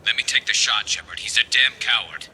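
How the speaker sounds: loud, high angry insistence; furious demand. Acted. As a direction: demand it with open contempt. Sniper demands to shoot traitor.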